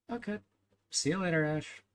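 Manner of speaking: high cheery farewell. Real, not acted. Farewell to viewer.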